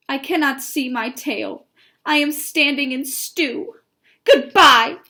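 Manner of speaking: grumble, then fling out a loud farewell; animated read-aloud mouse in a huff; huffy storybook mouse. Acted.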